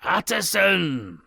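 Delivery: a trapped man calling out; hidden voice. Acted. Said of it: "said the voice".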